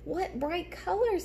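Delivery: a lightly voiced amazed sun. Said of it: "said the sun".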